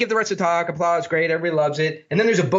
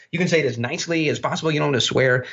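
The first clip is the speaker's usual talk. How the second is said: ordinary talk, nothing marked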